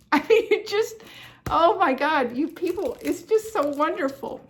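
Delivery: high, loud, laughing with delight; gushing thanks to the viewers, bursting with joy; overjoyed laughing gush. Real, not acted. Thanking viewers for the gifts, laughing with high, loud joy.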